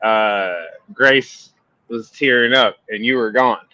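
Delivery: ordinary delivery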